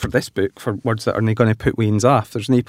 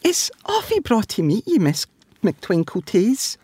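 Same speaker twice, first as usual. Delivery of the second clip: put-on very polite child voice; polite little sheep; 10 semitones higher than usual. A narrator's reading. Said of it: "said Susie".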